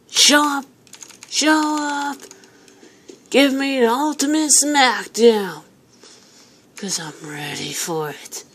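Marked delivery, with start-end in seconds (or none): 0.1-5.6 taunting call. Real, not acted. Challenging the animatronics.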